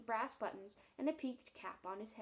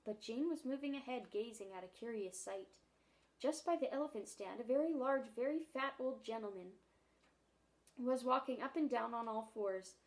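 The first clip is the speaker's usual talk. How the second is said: plain reading voice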